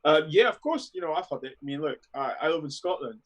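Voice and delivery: ordinary talk, nothing marked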